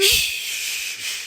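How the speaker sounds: soft whispered shush. Retold or imitated. Narrator hushing his crying friend in a whisper. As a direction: hush him urgently under your breath. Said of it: "I started to shush him".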